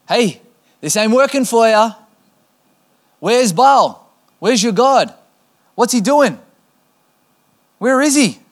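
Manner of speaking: high, loud jeering call; mocking taunt. Elijah taunting the prophets of Baal, high loud mockery. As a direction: needle them, mock their silent god. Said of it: "Elijah begins to taunt them".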